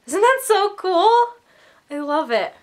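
excited. Real, not acted.

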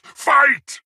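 high, loud one-word war cry; battle command shout. Acted. Warlord ordering the Spartan to fight, loud high shout. Announcer starts the combat.

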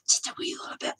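barely voiced, playful confession; conspiratorial whisper. Real, not acted. Stitcher confesses she takes shortcuts, in a sly whisper. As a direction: breathe it like a guilty secret.

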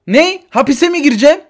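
high, loud disbelief; shocked. Acted. Woman told she is going to jail, shocked. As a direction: jolt up in alarm.

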